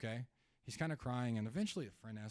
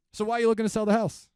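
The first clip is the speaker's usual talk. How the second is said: loud, fast, pushy sales question; blunt loud question; 9 semitones higher, 15 dB louder, faster than usual. Shown as a demonstration. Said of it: "when you have a type A seller and you say".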